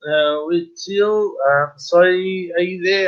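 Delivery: normal talking voice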